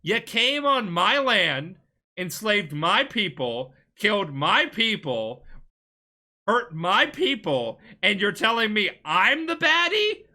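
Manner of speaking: loud, high, drawn-out outrage; indignant retort. Acted. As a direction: throw it back at them, hitting each grievance hard. Acting out indignant reply to invaders.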